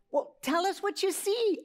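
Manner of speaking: lightly voiced excited piglets calling out; oinking piglets. A narrator's reading. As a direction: squeal it up like little piglets. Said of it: "oinked the piglets".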